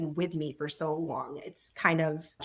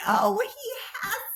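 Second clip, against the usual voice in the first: high, slow, choked-up mock wail; retold tearful wail; 13 semitones higher, slower than usual. Writer tells husband a character just died, high slow tearful mock wail. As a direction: replay her own late-night crying, drawn out and high. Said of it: "I'm just crying".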